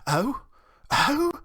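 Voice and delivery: rising, high, loud squeaks; startled little mouse exclaiming; astonished cry. A narrator's reading. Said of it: "cried Timmy Willie".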